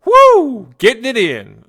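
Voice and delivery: mock eager yell. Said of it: "are all like".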